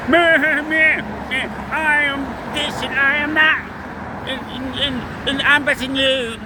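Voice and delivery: high, loud whiny mock voice; mocking braggart. Mimicking a boastful viewer, high whiny mockery. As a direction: sneer it like a bragging rival.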